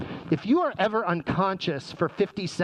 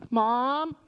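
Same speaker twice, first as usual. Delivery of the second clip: imitated kid calling for mom, pitched higher; calling child; 6 semitones higher than usual. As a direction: call out like a child at midnight. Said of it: "from your seventh grade son or daughter".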